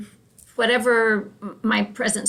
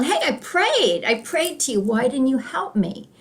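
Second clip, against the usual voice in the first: higher-pitched mock indignation; mildly angry; 4 semitones higher than usual. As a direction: complain to God, a little put out, as a joke character. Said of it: "he's a little angry at God".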